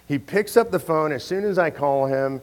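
normal talking voice

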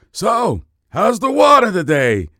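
high, loud, cartoonish old fish greeting; high-pitched hammed-up old fish asking the young ones. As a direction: play the old fish with a big put-on voice. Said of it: "old fish goes".

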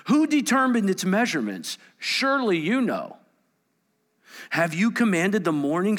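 loud, high, forceful reading of God's questions; preaching at full force. Acted. Preacher voicing God's questions to Job, forceful and loud.

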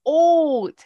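"Haute" as a high, loud "oht"; hammed-up French "Haute"; playful French word. Playing with French word, silent letters.

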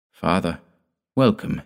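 ordinary delivery, nothing marked

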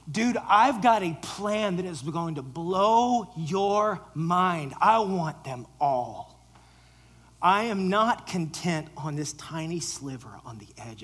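loud, slow, insistent; emphatic declaration. Acted. Jesus telling Peter his bigger plan, emphatic. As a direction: lay it down with weight.

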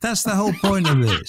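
laughing while insisting. Real, not acted. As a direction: say it through a laugh.

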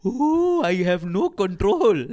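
slow, drawn-out wail from a drifting alien; floating alien. Acted. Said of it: "this fella's just floating around like".

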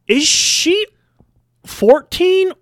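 high, loud incredulous questions; baffled guessing. Acted. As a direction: guess wildly, voice shooting up on each age. Acted bafflement at kids' ages.

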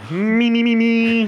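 high frog-puppet warm-up; Kermit. Pretend. Host warming up for co-hosts, Kermit voice. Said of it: "can you do a Boston Kermit?".

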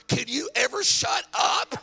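high, slow, laughing shout; laughing mock shout. Wife yelling at her chatty husband, high laughing shout. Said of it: "And she's like".

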